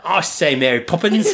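mock Cockney. Co-host teasing the failed accent, mock Cockney English. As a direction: put on a silly English accent, laughing. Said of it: "sounded a little more Cockney".

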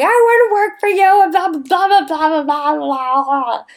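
high, loud, mock pleading waitress voice, fading off; brassy waitress. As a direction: ham up a brassy waitress begging for work. Cocktail waitress begging, acted quote.

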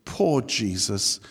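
low, soft lament for poor Jesus; drawn-out, low-voiced pity; mock pity. Preacher pitying Jesus to his congregation, mock pity.

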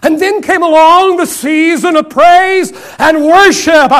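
slow, drawn-out, building sermon delivery; preaching cadence. Acted. Preacher describing the season of praise, slow and building. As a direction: stretch each phrase to the pulpit.

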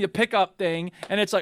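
ordinary talk

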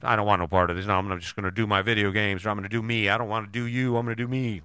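a hint of a surly spouse brushing you off; grumpy refusal. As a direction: grumble it like a sulking partner. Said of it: "if they tell you".

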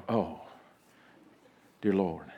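low, hushed dismay; quietly aghast, hammed-up; acted dread. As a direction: sink into a low, soft mock-horrified groan of words. Ladies dreading surprise visitors, acted.

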